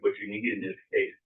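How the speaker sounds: ordinary talk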